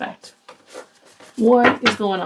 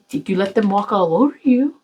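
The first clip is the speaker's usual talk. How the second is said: normal talking voice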